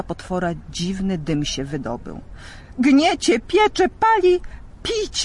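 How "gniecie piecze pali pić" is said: very high, loud, slow dragon bellow; roaring in pain. Acted. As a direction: howl as the fire burns inside. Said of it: "ryczał". (smok ryczy z bólu i pragnienia)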